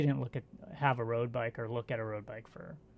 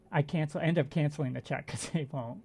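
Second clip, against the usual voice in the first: ordinary talk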